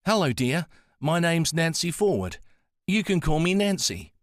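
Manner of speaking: gentle Antipodean lilt, welcoming a visitor; soft Antipodean accent. A narrator's reading. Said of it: "She spoke in a soft Antipodean accent".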